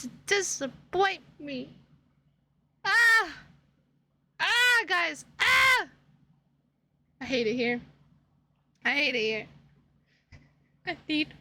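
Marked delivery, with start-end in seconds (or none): 5.4-5.9 high, loud exasperated yell; real shout of frustration; frustrated shout. Real, not acted. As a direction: yell it out at the chat.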